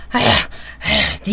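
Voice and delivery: slow, whispered, exhausted sighs; breathless panting sighs. Acted. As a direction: gasp out the sighs, spent from the fight. Palgae exhausted from fighting.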